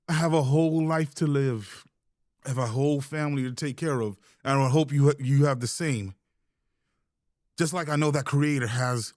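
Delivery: ordinary delivery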